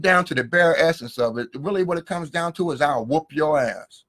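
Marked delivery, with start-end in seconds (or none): 2.8-4.0 slow, low street threat; a slow, low dare to fight; tough threat. Retold or imitated. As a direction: lay it down slow like a man ready to fight. Bare-essence threat between two men.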